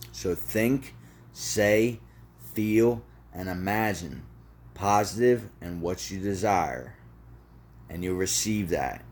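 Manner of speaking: spaced out phrase by phrase; deliberate repetition. Shown as a demonstration. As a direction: leave long pauses so each word lands. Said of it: "One more time for the people in the back".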